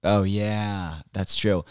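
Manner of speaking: ordinary delivery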